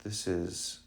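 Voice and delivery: usual speaking voice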